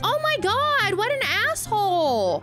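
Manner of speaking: high, indignant squeal; high indignant shrieks; outraged disbelief. Real, not acted. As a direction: protest at the thieving fish. Stalkers steal her camera.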